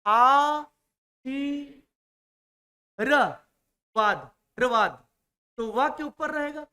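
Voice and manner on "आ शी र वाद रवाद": "आ, शी, र, वाद, रवाद" broken apart slowly; sounding out syllables. Shown as a demonstration. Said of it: "तो आप क्या बोल रहे हो?". ("रवाद" धीरे-धीरे टुकड़ों में)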